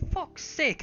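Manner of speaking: high-pitched irritated curse; exasperated dwarf. Acted. Dwarf stopping at the base of the stairs, exasperated curse. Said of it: "be like".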